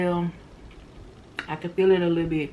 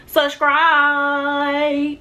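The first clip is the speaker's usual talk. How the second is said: playful call-out; 7 semitones higher, 5 dB louder than usual. Real, not acted. Catching her flub, calling out.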